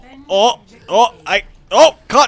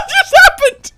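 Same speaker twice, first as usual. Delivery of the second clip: high, loud squeal through laughter; laughing disbelief; 5 dB louder, much slower than usual. Real, not acted. Gamer thrown by friend's remark, laughing disbelief.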